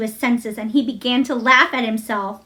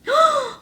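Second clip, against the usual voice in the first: high, sudden intake of breath; sharp gasp. Acted. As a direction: gasp in surprise. Boy hears Dickon is coming.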